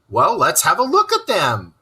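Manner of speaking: eager cry; cried out eagerly. Acted. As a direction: call it out jumping up. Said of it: "cried the rat".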